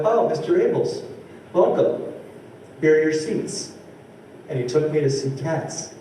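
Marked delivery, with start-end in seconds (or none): 0.0-3.7 welcoming usher voice, pitched higher; retold usher greeting. Usher greeting the comic by name, brightly welcoming. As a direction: play the gushing usher. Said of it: "He went".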